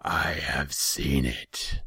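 slow, loud demon rumble; rumbling growl. A narrator's reading. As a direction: growl it out slowly. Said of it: "His voice was a rumbling growl".

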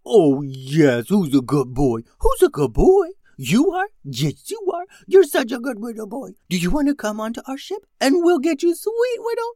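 high, far-off cooing puppy talk; baby talk to puppies. A narrator's reading. Ted gushes over rescued puppies in high baby talk. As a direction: coo at the puppies like a doting owner.

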